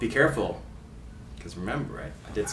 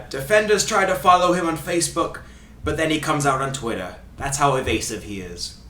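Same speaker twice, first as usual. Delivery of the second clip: put-on Ray Hudson Scottish accent. Host reading a quote about evasive player, fake Scottish accent. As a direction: fake Scottish commentator, falling at the end. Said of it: "doing his accent".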